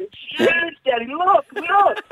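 put-on high-pitched child's voice calling Daddy; squeaky little boy shouting across the park; excited kid calling out. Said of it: "he kept going on".

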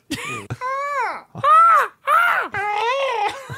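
high shrill whistled bird call. Pretend. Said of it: "I'm gonna do a bird call".

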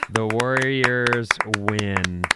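slow, stretched victory call; drawn-out announcement. Real, not acted. Host announcing the Warriors' win, drawn-out announcement. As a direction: draw out each word to proclaim the win.

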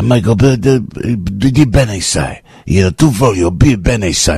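lightly voiced Italian movie character; slow, halting Italian line, lightly imitated; De Niro. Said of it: "De Niro goes".

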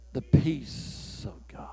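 slow whisper: "The peace of God". Acted. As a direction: breathe it out, very softly. Preacher hushes final answer.

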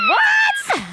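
shrieked, very high disbelieving what; stunned high-pitched cry of disbelief; shocked what. Retold or imitated. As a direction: scream it in total shock. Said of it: "And I'm like".

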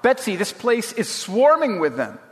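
high-pitched alarmed exclamation; crying out. A narrator's reading. Corrie warns Betsy about the fleas, crying out. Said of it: "I cried".

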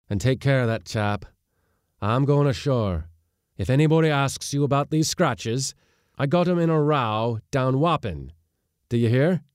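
a gruff captain covering for his mate. Acted. Skipper tells mate the cover story for his scratches, loud and gruff.

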